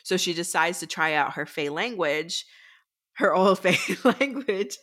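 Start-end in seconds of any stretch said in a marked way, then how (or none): 3.1-4.8 breaking into laughter mid-phrase; higher, slowed by giggles; laughing. Real, not acted. Storyteller loses it over her retelling, laughing through the words.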